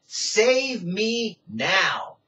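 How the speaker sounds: very slow Save us now; crying out. Shown as a demonstration. Preacher showing Hosanna as a cry to be saved, very slow. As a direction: drag out Save us now as a plea. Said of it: "what you wanna say to God".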